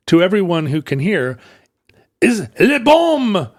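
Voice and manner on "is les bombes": put-on high, hammy French accent yelling; French accent shout. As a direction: yell it like a panicked French captain. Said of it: "he is shouting".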